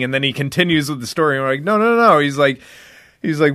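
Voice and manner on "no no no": high, louder protesting no no no; mock disbelief; incredulous no. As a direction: friends cutting him off in disbelief. Friends protesting retold.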